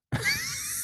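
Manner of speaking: high wheezing laugh. Real, not acted. Storyteller cracks up at the mess in the car, high laugh.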